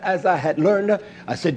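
ordinary talk, nothing marked

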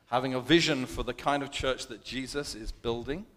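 usual speaking voice

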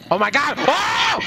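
loud startled cry; scared shout. Real, not acted. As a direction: yell out in fright. Scared by zombies.

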